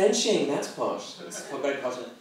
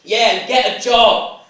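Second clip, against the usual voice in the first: loud, higher mock heckle; lad shouting; 7 semitones higher, 9 dB louder than usual. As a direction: yell it out the car window, hammed-up. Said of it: "one of the lads shouted out".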